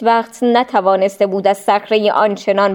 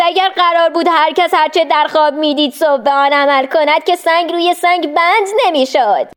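mocking devil calling; 7 semitones higher than usual. A narrator's reading. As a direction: shout after him to shake his resolve. Devil calls after Abraham.

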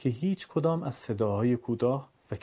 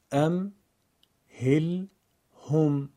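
ام، هل، هم in three separate pieces, pitched a little up; syllable by syllable; 4 semitones higher, much slower than usual. Shown as a demonstration. Teacher shows sakin letter joined to its vowel: ام، هل، هم spaced apart. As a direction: pause after each closed syllable of ام، هل، هم.

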